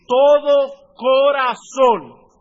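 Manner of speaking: building to a loud, very slow corazón; rising preaching shout. Real, not acted. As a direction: swell to a shout on the last word. Preacher builds Christ's warning.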